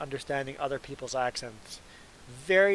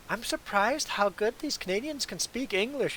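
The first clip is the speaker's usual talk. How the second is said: imitated Southern US drawl, pitched up; higher, lilting mock Southern accent; thick southern drawl; 6 semitones higher than usual. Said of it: "in that thick southern drawl".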